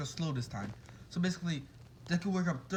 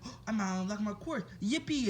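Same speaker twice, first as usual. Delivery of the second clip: excited; 5 semitones higher than usual. Retold or imitated. Deku thinks he unlocked his quirk, excited. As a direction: imitate an excited kid, voice rising high. Acted delight at new powers.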